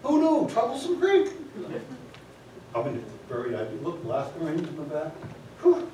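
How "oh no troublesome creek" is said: alarmed. Retold or imitated. Alarmed quote.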